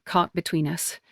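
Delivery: plain reading voice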